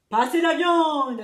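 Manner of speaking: loud, slow barked order; pirate chief ordering the meat. A narrator's reading. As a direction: command the table to pass it. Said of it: "a-t-il ordonné".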